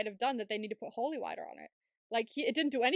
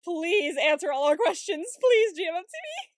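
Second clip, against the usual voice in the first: playful begging; 5 semitones higher, 8 dB louder than usual. Pretend. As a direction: beg loudly, climbing high at the end. Mock plea to the show's makers.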